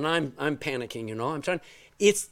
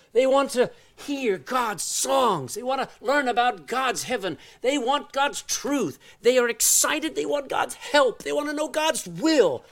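rising fervour; 7 semitones higher than usual. Acted. Preaching builds about eager people.

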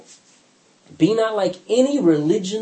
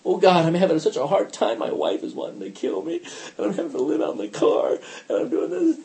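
put-on self-pitying plea; mock complaining prayer. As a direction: parody a woeful worshipper listing troubles. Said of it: "that's how we pray".

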